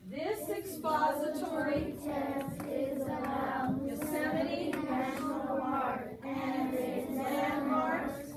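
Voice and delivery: very slow, loud read-along; loud, drawn-out group reading; reading aloud together. Shown as a demonstration. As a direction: stretch each word so the class can follow. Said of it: "Listen to your voice".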